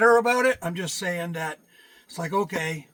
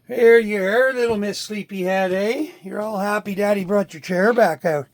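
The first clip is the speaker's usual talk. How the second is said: raised, louder pet-greeting voice; talking to a pet; 4 semitones higher, 8 dB louder than usual. Real, not acted. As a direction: fuss over a sleepy little pet. Cooing to the pet.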